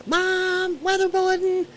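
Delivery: very high, mock-panicked squeal; screaming child, imitated. Retelling running from the TV to Mom, mock scream. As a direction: shriek for Mom like a freaked-out kid, hammed up. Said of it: "run out of the room screaming".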